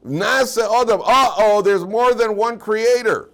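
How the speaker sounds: hammed-up worried heretic; alarmed heretic. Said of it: "if a heretic reads this pasuk".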